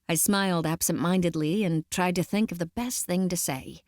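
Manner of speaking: plain reading voice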